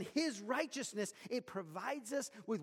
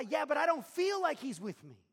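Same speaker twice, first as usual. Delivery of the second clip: high, loud objecting voice; doubting believer; 7 semitones higher, 10 dB louder than usual. Retold or imitated. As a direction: push back like a doubtful listener. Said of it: "To which we reply".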